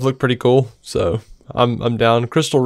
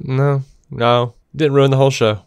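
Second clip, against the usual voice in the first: ordinary talk, nothing marked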